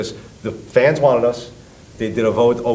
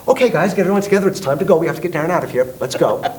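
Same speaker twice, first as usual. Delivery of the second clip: high, hurried herding of the group; imitated flustered nice guy, fast and pitched up; nice guy rallying; 8 semitones higher, faster than usual. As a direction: urgently round everyone up to leave. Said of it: "Really nice guy, doesn't curse".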